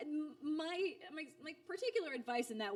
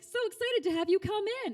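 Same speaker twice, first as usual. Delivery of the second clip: higher and louder, bubbly welcome; excited writer; 13 semitones higher, 11 dB louder than usual. Retold or imitated. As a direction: gush the welcome like a thrilled writer. Quoting the lead writer's message.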